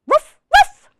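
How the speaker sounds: high, loud double woof; puppy woof. Acted. Biscuit answering the reader, high loud puppy woofs. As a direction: yip like an eager little puppy. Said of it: "And Biscuit says".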